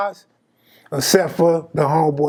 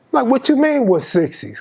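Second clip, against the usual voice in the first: retold irritated question, higher on "what you mean"; a little irritated; 7 semitones higher than usual. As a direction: push back, mildly annoyed. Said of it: "I'm getting a little irritated".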